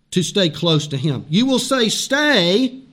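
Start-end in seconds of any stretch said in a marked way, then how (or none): 1.9-2.8 higher, drawn-out emphatic appeal; raised-pitch preacher's mock plea; pleading. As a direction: plead it with a pause after the first word. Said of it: "you plead with him to stay with you".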